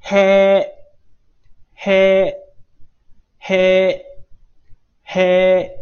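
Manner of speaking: loud, held へ with exhaled onset; へ drilled loud and level; breathed-out h. Shown as a demonstration. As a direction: push air out into へ, then sustain it. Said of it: "读作呼气".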